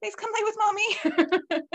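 high, slow pleading to the dog; coaxing baby talk. Retold or imitated. Owner begging her dog back onto the bed, coaxing.

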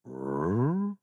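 low questioning growl with a rising end; deep Wookiee growl. A narrator's reading. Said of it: "uttered a deep".

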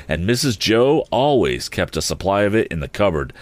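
ordinary delivery, nothing marked

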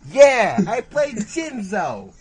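put-on high, slow Brooklyn kid voice; Joey impression. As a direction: drag out the words in a kid's boast. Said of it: "Joey impression".

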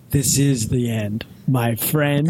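low, slow mock-ominous movie line; kid's grim retort. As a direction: deliver the payoff slowly and low. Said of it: "And the kid goes".

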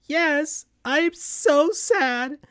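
high falsetto cartoon sidekick, mournful; Tails, sad. A narrator's reading. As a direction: squeak out sadness, stretching the words. Tails answers mom.

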